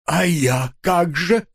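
slow, high, drawn-out whine; plaintive pleading. A narrator's reading. Husband asks his leaving wife about himself, plaintive. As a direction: stretch it out pitifully.

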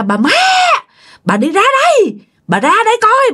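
high wailing ghost call; ghostly summons. Acted. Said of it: "Kêu rằng á là".

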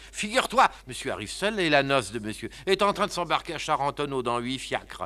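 usual speaking voice

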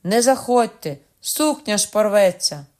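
loud, high alarmed warning; shouting. Acted. Governess shouts at the crowd to keep out, loud. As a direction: yell to save the dress.

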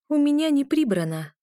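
high-pitched dismissive brush-off; snorted retort. A narrator's reading. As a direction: snort it as a curt refusal. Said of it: "фыркнула я".

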